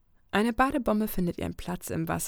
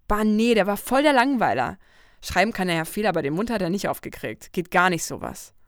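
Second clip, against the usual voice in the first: loud, dismissive; scornful Lucy; 2 semitones higher, 8 dB louder than usual. Acted. Lucy mocks a letter writer as a bore, loud and scornful. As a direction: wave off a dull guy with a groan.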